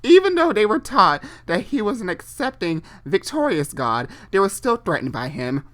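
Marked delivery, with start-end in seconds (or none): none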